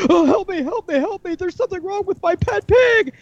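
high frantic lady voice; lady pleading with the vet, high frantic. Retold or imitated. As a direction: shriek for help at the vet.

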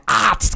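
mock primal yell, very high; imitating the lifting grunt. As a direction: shout it like a gym war cry. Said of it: "originally said".

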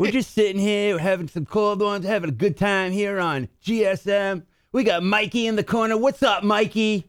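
Acevedo impression. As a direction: play it cool, like you don't care. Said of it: "to do Acevedo, you gotta kinda go there".

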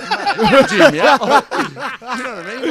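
high, loud, laughing mock coach; giggling through the coach's line.

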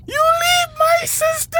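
high, slow screaming order; desperate shout. Retold or imitated. Boy screaming at the man beating his sister, high slow yell. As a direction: yell it with all you have to stop him. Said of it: "he just come, woof, woof".

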